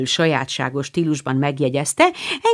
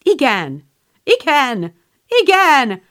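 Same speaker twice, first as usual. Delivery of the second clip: eager yes; 8 semitones higher, 4 dB louder, slower than usual. Acted. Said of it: "felelte egyszerre a három hang".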